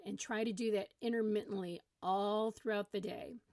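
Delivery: usual speaking voice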